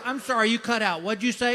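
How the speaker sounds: high, loud nurse voice asking him to repeat; mock nurse voice, high and loud; nurse on the phone. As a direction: play the confused nurse. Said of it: "And she goes".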